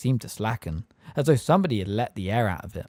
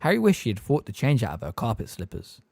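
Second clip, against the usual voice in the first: usual speaking voice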